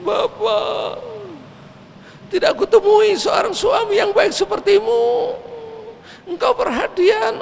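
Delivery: high, tearful lament to her dead husband; grieving wife begs her husband not to leave; crying widow. Acted. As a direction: weep the praise and the plea. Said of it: "dia menangis".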